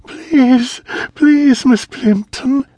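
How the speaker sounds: high, loud begging scream, slowing on the name; screaming plea. A narrator's reading. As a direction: plead in a desperate scream. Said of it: "Anne screamed".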